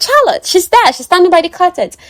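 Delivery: high, loud, eager pointing out; a breathless bright announcement, pitched well up; excited Hermione. Retold or imitated. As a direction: chirp it out, high and loud, like a girl showing her friend. Hermione answers excitedly, acted quote.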